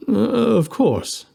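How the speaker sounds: high, slow nervous stall; nervous bluff. Acted. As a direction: stall for time, high and unsure.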